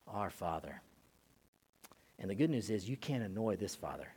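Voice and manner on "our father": much softer, lower reverent address; quiet prayer. Acted. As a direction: whisper it like a child praying. Children coming in prayer.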